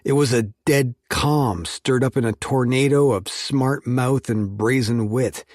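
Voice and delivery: plain reading voice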